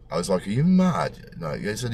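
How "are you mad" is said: shocked. Retold or imitated. Shocked at prognosis.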